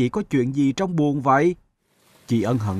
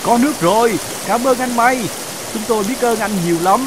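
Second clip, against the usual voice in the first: higher, louder delighted fish; joyful thanks; 8 semitones higher, 4 dB louder than usual. Acted. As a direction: burst out grateful and happy. Fish rejoice at rain.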